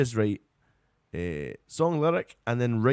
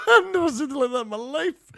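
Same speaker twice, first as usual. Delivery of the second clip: high, loud squeal of real laughter; laughing hard; 13 semitones higher, 6 dB louder than usual. Real, not acted. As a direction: barely get it out through helpless laughter. Helpless laughter at melting glue.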